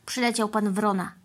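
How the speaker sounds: usual speaking voice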